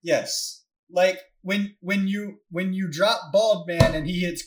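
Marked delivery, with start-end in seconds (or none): none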